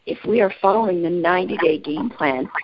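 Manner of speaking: usual speaking voice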